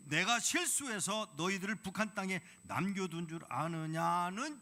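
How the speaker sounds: 높고 크게 울리는 하나님의 물음; 크게 꾸짖듯 되묻기; booming proclamation. Retold or imitated. God's question proclaimed. (크게 외치는 하나님의 물음)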